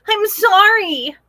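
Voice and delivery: high, slow squealed apology. Acted. Piglet apologizing to the hen, high squeal. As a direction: squeal it like a little pig. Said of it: "Posy squealed".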